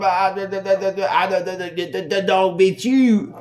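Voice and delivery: loud, high stuttering buddy; imitated panicked stutter; shell-shocked stammer. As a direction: get stuck on the words, then blurt them out. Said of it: "he's shell-shocked more than I am".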